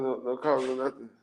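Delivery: ordinary talk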